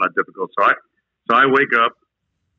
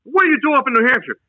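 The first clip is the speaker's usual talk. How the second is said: drill sergeant bark; 12 semitones higher, faster than usual. Retold or imitated. Drill sergeant questioning recruit.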